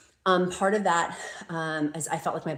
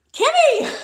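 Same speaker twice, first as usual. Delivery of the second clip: a sudden high, loud cry of a name; excited shout; 11 dB louder than usual. Real, not acted. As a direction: shout her name in delighted surprise. Spotting a donor's name, thrilled.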